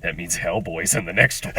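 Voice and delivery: dry sarcastic aside, low and quiet; deadpan sarcasm to a friend. Retold or imitated. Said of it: "I lean over to Brian sarcastically".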